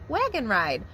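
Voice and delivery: ordinary delivery, nothing marked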